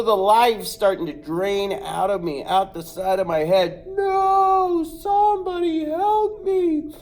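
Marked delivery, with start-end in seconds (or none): 3.9-6.9 much higher, drawn-out cry for help; desperate plea. Retold or imitated. The boy pleads for help as the rooster claws his head. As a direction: beg for help, fading.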